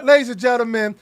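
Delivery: a touch of a hyped show host; mock announcer. Host mock-introduces guest like a showman. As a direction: announce her like a star, half joking.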